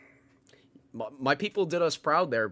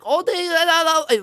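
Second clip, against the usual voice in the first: hammed-up mock Chinese accent, much higher and louder; 12 dB louder than usual. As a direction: parody a Chinese accent in a shrill, loud put-on voice. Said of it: "their accents are obviously like".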